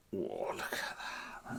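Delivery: hushed breathless whisper at the sight; soft wondering whisper, almost no voice; awed whisper. Real, not acted. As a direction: whisper it in amazement. Opening the bottle, awestruck.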